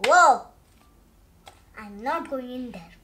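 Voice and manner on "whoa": startled whoa. Acted. Pigeon sees the tub.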